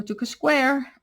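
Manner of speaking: ordinary talk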